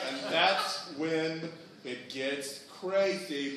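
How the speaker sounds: usual speaking voice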